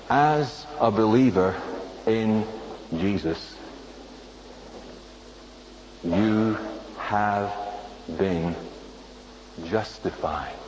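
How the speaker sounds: a hushed, spaced-out statement; quiet and slow. Shown as a demonstration. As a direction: lay it down quietly, one word at a time. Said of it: "Let me say it again".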